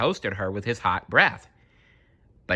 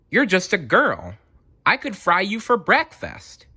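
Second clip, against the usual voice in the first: high, loud astonished cry; amazed dragon; 11 semitones higher, 3 dB louder than usual. Acted. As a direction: blurt it out in disbelief. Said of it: "The dragon was amazed".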